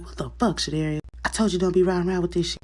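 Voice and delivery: lightly acted telling-off; exasperated scolding. Boyfriend scolding girlfriend over weed, exasperated.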